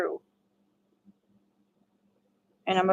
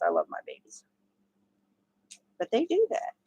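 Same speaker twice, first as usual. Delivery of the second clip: normal talking voice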